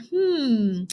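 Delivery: ordinary talk, nothing marked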